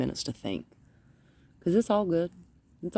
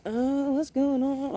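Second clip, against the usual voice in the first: much higher, slow and puzzled dog voice; voicing the dog; 11 semitones higher, slower than usual. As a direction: play the confused dog, pitched way up. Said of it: "She's like".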